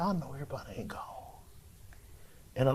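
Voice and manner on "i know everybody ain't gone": hushed, unvoiced whisper. Waking alone in the dark church, whispering to himself. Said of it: "And I said".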